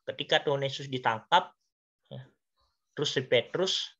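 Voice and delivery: usual speaking voice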